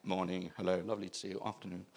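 low, quiet flustered hellos; mock posh greeting. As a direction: mumble stiff pleasantries. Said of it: "They were like".

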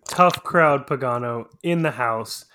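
loud hype intro for the show; big show-opening welcome with drawn-out names; show announcer. Acted. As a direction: announce the hosts like a ring announcer, punchy.

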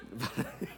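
low, short chuckle; soft chuckle. Real, not acted. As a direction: chuckle quietly at your own joke. Laughs after joke about wife.

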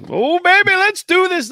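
high, loud hype shout; hyped up. Real, not acted. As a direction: pump up the room. Host hyping up the show.

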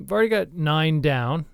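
ordinary talk, nothing marked

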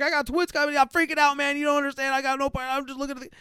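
very high, panicky babble; frantic, pleading rush of excuses, acted; dad freak-out. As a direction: imitate a new dad losing it. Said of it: "classic dad freak out, like, new dad freak out".

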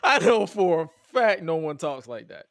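loud, laughing incredulity; baffled and cracking up; laughing disbelief. Real, not acted. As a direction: insist on it through real laughter. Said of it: "my confusion turns into laughter".

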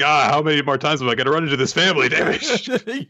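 high, rushed comic exasperation; mock exasperation. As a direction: blurt it out as a fed-up salesman, half laughing. Imagined exasperated salesman, joking.

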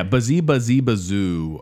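slow, drawn-out imitation of the wind; mock wind noise. Host teasing the story's odd wind noise. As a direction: tease the story's wind sound.

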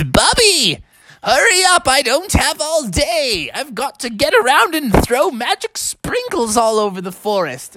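the wizard rushing the bear; impatient wizard. Acted. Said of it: "The old man says".

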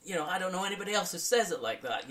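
high, fast, thrilled voice; excited. Retold or imitated. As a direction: rave about it breathlessly. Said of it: "I just wanna copy them".

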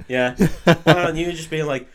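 ordinary talk

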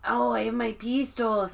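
Speedy Gonzalez. Retold or imitated.

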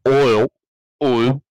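loud mock-Southern "oil" with its glide dropped; loud, exaggerated Southern "oil"; imitated Southern oil. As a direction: blurt "oil" with the I deleted. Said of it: "Imagine saying".

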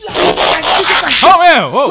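high, loud startled outburst; loud surprised yell. Acted. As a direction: jump out of your skin.

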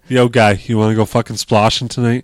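put-on loud Boston bro accent; put-on Boston tough guy. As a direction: pitch a night out in a Boston accent. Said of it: "the best Boston accent you're gonna get".

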